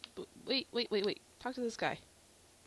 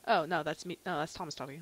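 usual speaking voice

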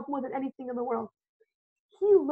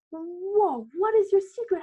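higher and louder in awe; amazed whoa; 4 semitones higher, 3 dB louder, slower than usual. Retold or imitated. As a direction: gush over the secret. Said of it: "I'm like".

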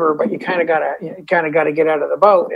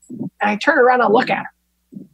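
normal talking voice

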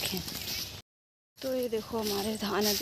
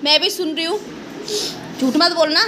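scolding; 7 semitones higher, 7 dB louder than usual. Real, not acted. Mother scolds her son for swearing, loud and sharp. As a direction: tell him off sharply, warning him.

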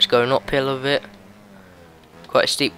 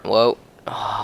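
ordinary talk, nothing marked

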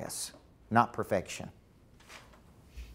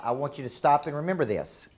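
usual speaking voice

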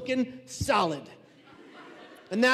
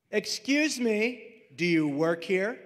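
sassy; slower than usual. Acted. Woman challenges volunteer before staff, slow sassy question. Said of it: "She has sass".

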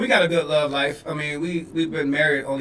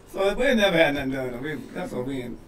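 ordinary talk, nothing marked

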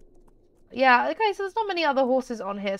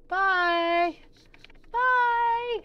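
bright high-pitched bye to a horse; high goodbye to horse; 12 semitones higher, 4 dB louder than usual. Real, not acted. Player bids her horse farewell, high sing-song bye.

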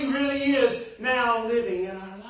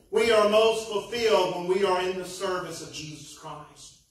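ordinary talk, nothing marked

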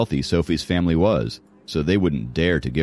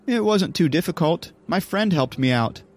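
put-on high-pitched girl, pleased with herself; reply in a high, girlish voice, beaming; proud little schemer, pitched high; beaming Sophie; 12 semitones higher than usual. A narrator's reading. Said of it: "beaming at her successful sneak out".

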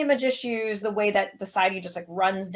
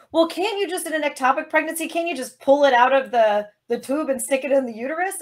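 mocking Republican; 6 semitones higher than usual. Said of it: "what Republican was like".

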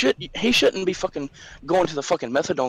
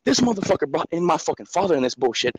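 ordinary delivery

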